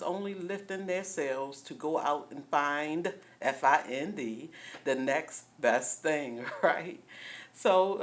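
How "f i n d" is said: "F I N D" spelled letter by letter. Shown as a demonstration. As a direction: spell "F I N D" one letter at a time.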